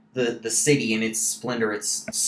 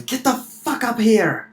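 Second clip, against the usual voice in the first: high, loud acted yell; captain's rough shout, pitched way up; 11 semitones higher, 6 dB louder than usual. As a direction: holler orders to people below deck.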